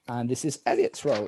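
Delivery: normal talking voice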